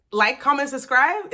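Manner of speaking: high, loud, sing-song outro voice; mock YouTuber sign-off. Shown as a demonstration. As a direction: pitch it like a YouTuber. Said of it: "you're supposed to say it".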